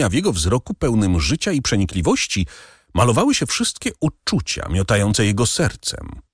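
plain reading voice

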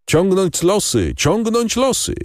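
narrated mock clamour, pitched well up; clamouring bandits. A narrator's reading. Bandits calling over one another to their chief, high mild clamour. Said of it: "wołali jeden przez drugiego bandyci".

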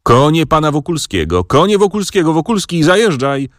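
high relayed shout, passed down the line; coachmen echoing the call. A narrator's reading. Waiting coachmen relay the call for Wokulski's carriage, high-pitched. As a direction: echo the call in several high voices, ending in a command. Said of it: "powtórzyli stojący furmani".

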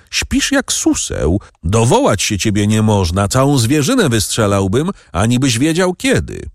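usual speaking voice

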